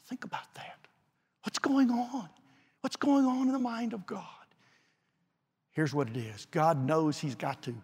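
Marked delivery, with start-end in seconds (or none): 0.1-0.9 hushed breathy whisper. Real, not acted. Preacher hushing the congregation after shouting, whisper. 1.4-4.2 high hushed questions. Real, not acted. Preacher pondering God's mind to congregation, high hushed wonder. Rhetorical questions after whisper.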